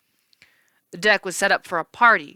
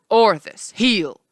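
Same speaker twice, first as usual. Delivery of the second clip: firm command; 3 semitones higher, 5 dB louder, much slower than usual. Acted. Herdsman commands his dog.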